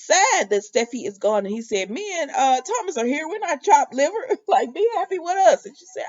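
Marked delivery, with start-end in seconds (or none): 1.9-5.7 mock indignant. Ridge protests, voiced high.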